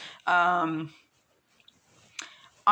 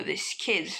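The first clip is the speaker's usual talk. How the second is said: plain reading voice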